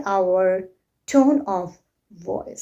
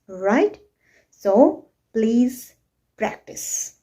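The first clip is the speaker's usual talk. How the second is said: usual speaking voice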